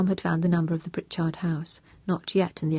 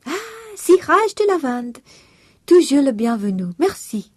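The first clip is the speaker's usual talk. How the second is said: lilting Madame Annette greeting; French housekeeper voice; 9 semitones higher, 8 dB louder than usual. A narrator's reading. Housekeeper greets Tom home, in a high French voice.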